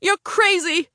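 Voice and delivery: high-pitched alarmed shout. A narrator's reading. Passenger protesting the driver's game of chicken, shouting. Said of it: "I shouted".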